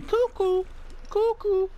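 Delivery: high, hooting cuckoo-clock call; mocking cuckoo call. Player mocking the backwards game page, mocking cuckoo call.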